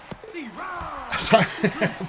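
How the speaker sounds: high laugh. Real, not acted. Laugh before strikeout call.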